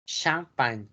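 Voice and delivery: Champagne repeated as a French model; French Champagne, final E silent; French pronunciation. Shown as a demonstration. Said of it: "escucha bien raro".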